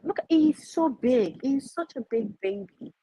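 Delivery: high, cooing pet voice. Real, not acted. Talking to her cat.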